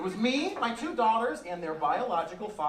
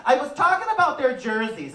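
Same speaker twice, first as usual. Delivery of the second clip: daughter's reply; 8 semitones higher, 7 dB louder than usual. Retold or imitated. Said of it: "she said".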